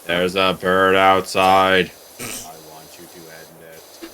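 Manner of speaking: put-on old guru asking for a bird to be killed, deep and slow. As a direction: rumble it like an ancient all-knowing elder. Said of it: "the D B Z A guru".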